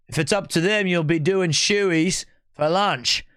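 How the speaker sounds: bad Australian accent. Streamer welcoming another stream's viewers, bad Australian accent. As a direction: put on a poor Aussie accent, stretching key words. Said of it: "I suck at Australian accent".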